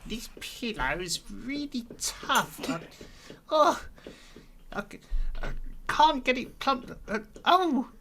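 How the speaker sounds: very high, squeaky character voice; puzzled and high, in halting little bursts; waking Gax, befuddled. Acted. Gax waking on what seems a pillow, befuddled squeak.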